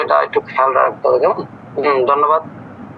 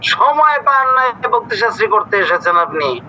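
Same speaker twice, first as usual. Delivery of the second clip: incredulous; 5 semitones higher than usual. Real, not acted. Teacher scolds unprepared student.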